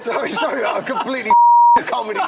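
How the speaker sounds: giggling through a sorry, high and loud; helpless laughter mid-apology; laughing apology. Real, not acted. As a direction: break up laughing while apologising. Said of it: "You did warn us".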